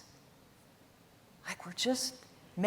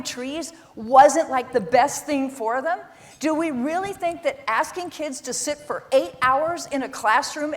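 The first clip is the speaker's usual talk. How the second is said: impassioned rant; 5 semitones higher, 6 dB louder than usual. Real, not acted. Passionate rant about kids and schools.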